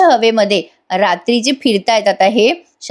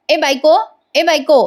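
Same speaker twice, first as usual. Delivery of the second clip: higher-pitched call to a wife; singled-out summons, pitched up but not shouted; 6 semitones higher than usual. A narrator's reading. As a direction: call her in from another room. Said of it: "असं म्हणून त्याने सायलीला आवाज दिलाय".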